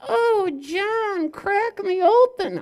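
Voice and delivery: high, loud, drawn-out mock cry; puppet character voice. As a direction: call out high and slow as a puppet.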